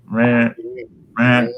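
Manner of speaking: flat repeated meh like an echo; mocking blah blah. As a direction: drone meh, meh like the glitch. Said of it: "It goes".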